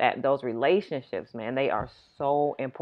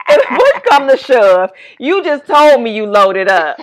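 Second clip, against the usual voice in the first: laughing, louder, through the words; laughing teasing; 8 semitones higher, 14 dB louder, slower than usual. Real, not acted. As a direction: tease her while cracking up. Teasing sister about her groceries.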